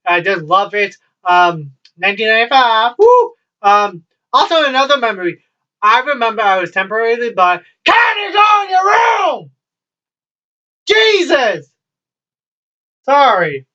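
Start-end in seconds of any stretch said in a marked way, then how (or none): 3.0-3.3 short, high, loud woo; excited whoop. Real, not acted. Cheering for something loved. 7.8-11.7 very high, drawn-out exasperated shout; real yell. Real, not acted. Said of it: "I don't mean to yell".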